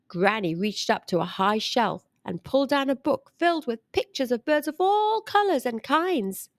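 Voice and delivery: plain reading voice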